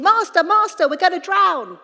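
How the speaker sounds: loud, high-pitched cry of alarm; panicked shouting. A narrator's reading. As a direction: shout it as if about to drown.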